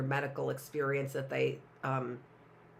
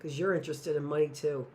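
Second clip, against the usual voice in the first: ordinary delivery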